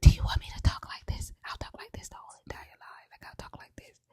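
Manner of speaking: hushed, breathy whisper of a retort; imitated whisper. Friend retelling Rhea's answer on a live, whispered. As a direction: whisper it fast, barely audible. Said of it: "Rhea whispered the whole live".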